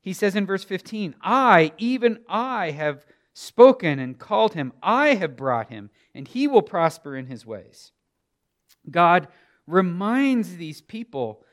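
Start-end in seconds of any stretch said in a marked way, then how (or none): 1.2-7.9 loud, hard stress on each "I"; forceful scripture proclamation; emphatic I's. Acted. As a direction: declare it as God, leaning on every "I". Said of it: "Notice the emphatic I's".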